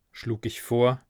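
ordinary delivery

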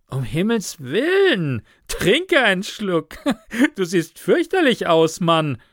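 high, loud exclamation with a laugh; amused cry, laughter breaking in; calling out laughing. A narrator's reading. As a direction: burst out laughing mid-speech. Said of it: "rief Jake lachend aus".

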